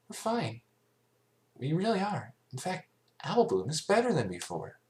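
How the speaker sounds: brushing off the fuss; little filly voice. A narrator's reading. Sweetie Belle reassuring Fluttershy, chirpy.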